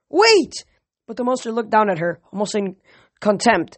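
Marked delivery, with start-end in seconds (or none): 0.1-0.6 high, loud call to stop; shouting. A narrator's reading. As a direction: yell for her to wait. Calling out to charging girl.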